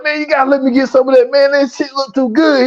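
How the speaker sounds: lightly mimicked greedy ask; eager mock plea. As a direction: rush it out hungrily.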